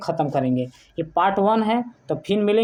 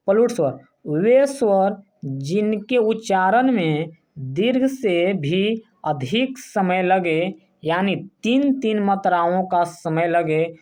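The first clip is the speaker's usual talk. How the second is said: slow dictation; slower than usual. Shown as a demonstration. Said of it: "teacher defines slowly".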